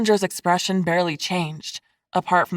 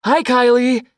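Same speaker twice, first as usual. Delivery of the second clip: loud, raised-pitch hello; enthusiastic greeting; 6 semitones higher, 6 dB louder than usual. A narrator's reading. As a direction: call back with keen enthusiasm. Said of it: "He waved enthusiastically".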